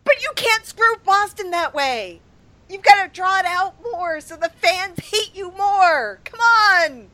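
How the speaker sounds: very high-pitched put-on heckling; mock protest. Co-host jokingly objecting to shorter reviews, mock protest. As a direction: push the pitch way up and plead the absurd case.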